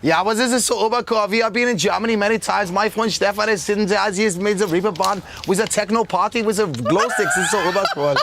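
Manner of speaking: enthusiastic German-accented English. Shown as a demonstration. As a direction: talk like a German party-goer.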